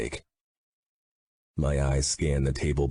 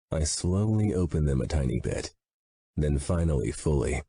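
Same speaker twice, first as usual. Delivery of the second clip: usual speaking voice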